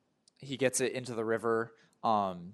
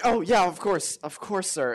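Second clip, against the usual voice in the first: higher, quick-to-oblige reply; eager compliance; 8 semitones higher, 6 dB louder than usual. Acted. Handler answers the screaming director, eagerly obliging. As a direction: answer the boss as if just noticing.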